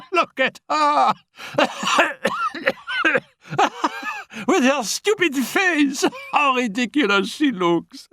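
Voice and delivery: high, laughing ridicule; mocking laughter. Annette mocking the kneeling Félicie, high laughing scorn. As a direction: laugh at her while you speak. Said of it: "flung her head back and laughed".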